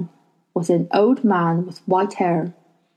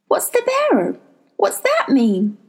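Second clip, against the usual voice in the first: put-on young girl voice; 11 semitones higher than usual. A narrator's reading. Lyra asks.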